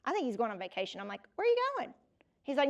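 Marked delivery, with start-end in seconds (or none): 1.3-1.9 pitched way up, baffled; surprised question. Retold or imitated. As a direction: ask it in sudden disbelief. Surprised builder is leaving.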